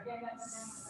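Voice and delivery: soft hissed S; s sound. Shown as a demonstration. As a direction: breathe out a long S. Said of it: "I've got the big".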